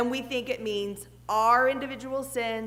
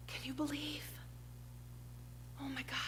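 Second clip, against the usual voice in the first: much softer, almost breathed under the breath; hushed gossip; 17 dB quieter, faster than usual. Retold or imitated. Neighbor to neighbor after a tragedy, hushed and much softer. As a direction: lean in and hush it like shocked gossip. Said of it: "they said".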